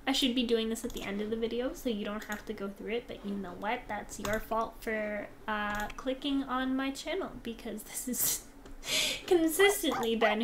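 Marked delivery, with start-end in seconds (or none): none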